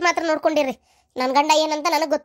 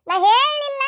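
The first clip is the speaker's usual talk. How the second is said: insistent denial; 7 semitones higher, 3 dB louder than usual. Acted. Repeats denial, louder and higher.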